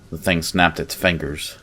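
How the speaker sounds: plain reading voice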